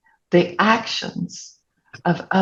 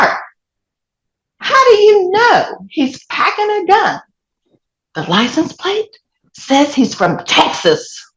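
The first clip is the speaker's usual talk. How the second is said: incredulous question; 10 semitones higher than usual. Retold or imitated. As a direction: challenge her husband in high astonishment. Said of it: "I said".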